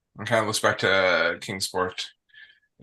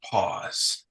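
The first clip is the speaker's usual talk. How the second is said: whispered; 4 dB quieter than usual. Acted. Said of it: "he says".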